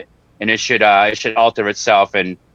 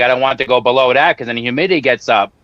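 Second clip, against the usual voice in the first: ordinary delivery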